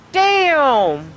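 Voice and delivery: high, loud cry of dismay; loud exclamation. Real, not acted. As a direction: belt it out in frustration. Dismay at having to knock down fifty.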